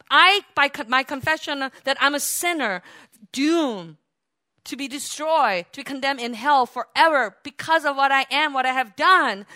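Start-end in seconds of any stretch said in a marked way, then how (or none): none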